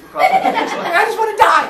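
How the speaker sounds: high loud squeal of disgust, laughing; disgusted shriek. Retold or imitated. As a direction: kid feeling cobwebs on his face, loud disgusted shriek. Cobwebs in crawl space.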